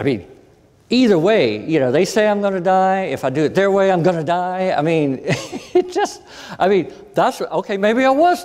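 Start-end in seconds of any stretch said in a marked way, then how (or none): none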